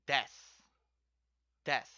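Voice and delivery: des said clipped and falling; model word des. Shown as a demonstration. Teacher models des for students, clipped and falling. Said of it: "you wanna say".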